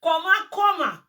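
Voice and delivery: loud angry cry of koma; shouting. Real, not acted. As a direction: shout it out in outrage. Angry rant opening.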